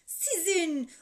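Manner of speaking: loud, indignant, climbing at the end; angry confrontation. A narrator's reading. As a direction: throw the accusation in his face. Baba kapıdaki öbür babayla kavga ediyor. (öfkeyle, yüksek sesle meydan okuma)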